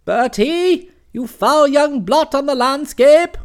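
loud, slow, imperious bellow; a formidable aunt roaring at her nephew; booming aunt. Acted. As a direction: boom it down the phone like a hunting horn. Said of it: "her voice came booming at me like a pack of hounds".

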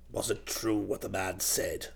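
very low, hushed interrogation; stern uncle. A narrator's reading. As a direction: question the nephew softly, deep in the voice. Duke questions his nephew.

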